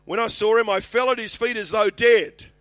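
very loud, high, emphatic reading; Scripture proclamation. Acted. Preacher reads John falling at Jesus' feet, proclaiming forcefully. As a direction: project the verse at full force.

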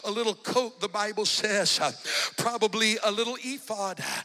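loud, rising preacher's cadence with gasped uhs; preaching at full force. Acted. Preacher building the congregation to a loud climax. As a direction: preach it hard, each phrase driven up.

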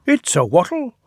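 high, bright little engine asking; piping tank engine question; Bill's high voice. Acted. Little Bill asking his twin, high and eager.

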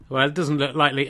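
ordinary talk, nothing marked

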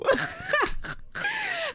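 squealing laugh; high shrieking laugh. Real, not acted. Laughing while retelling rage.

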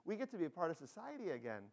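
rally the group with excitement; excited lepers. Acted. Healed lepers set off for the temple together, excited. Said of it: "They're all excited".

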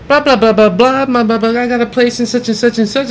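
mocking chatter.

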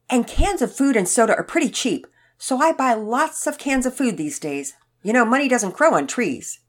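put-on chatty elderly man. A narrator's reading. Elderly man explaining why he buys cans, emphatic. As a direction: explain it emphatically as a thrifty old neighbor.

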